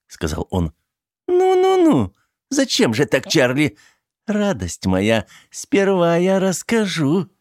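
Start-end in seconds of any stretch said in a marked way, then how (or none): 1.3-7.3 mocking coo. Teddy mockingly coaxes Charlie.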